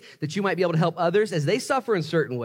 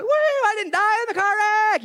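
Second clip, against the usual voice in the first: mock cheering shout; 6 dB louder than usual. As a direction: whoop like a thrilled fool. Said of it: "you jump out".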